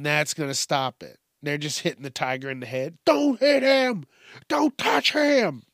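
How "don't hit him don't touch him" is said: high, slow, hysterical mock yell; mock screaming bystander. Zoo onlooker yelling at a tiger, mock high scream. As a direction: imitate a panicked crowd member wailing. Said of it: "and people are throwing".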